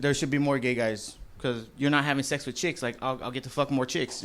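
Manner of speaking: usual speaking voice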